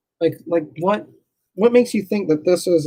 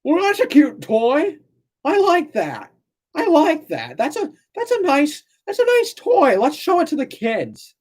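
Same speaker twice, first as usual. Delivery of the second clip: mocking ancient Greeks; 12 semitones higher than usual. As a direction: coo it like a dim onlooker. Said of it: "They're like".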